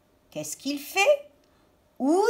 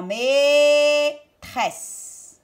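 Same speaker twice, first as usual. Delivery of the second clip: MAÎTRESSE with MAÎ held very long; drawn-out syllables; 3 semitones higher, 5 dB louder than usual. Shown as a demonstration. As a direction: stretch MAÎ, then say TRESSE. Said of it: "Est-ce que j'entends A".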